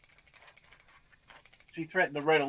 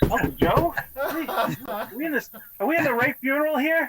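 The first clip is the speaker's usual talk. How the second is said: higher, laughing disbelief at the wrong eulogy; baffled laughing question; 7 semitones higher, 3 dB louder than usual. Retold or imitated. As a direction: ask through a laugh, half unbelieving. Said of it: "we're all looking around going".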